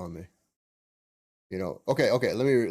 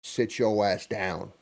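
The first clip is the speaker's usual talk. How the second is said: louder, slow and firm, "sit your ass down"; mock stern order; 2 semitones higher, 7 dB louder, slower than usual. Husband telling his wife to rest, loud and slow. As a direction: lay down the law to her, drawn out. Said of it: "I said".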